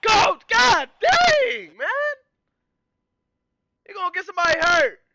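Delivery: very high, very loud Coach yells; full incredulous shout. Real, not acted. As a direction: scream at the coach in mock outrage. Yelling at the coach on replay.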